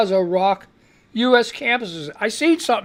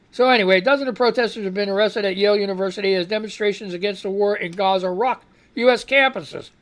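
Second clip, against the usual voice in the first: ordinary delivery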